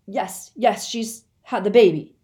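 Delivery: loud happy shout. Acted. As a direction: shout it back, overjoyed. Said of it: "shouted Lupin".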